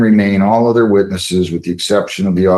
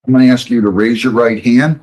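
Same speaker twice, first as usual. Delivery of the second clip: ordinary talk, nothing marked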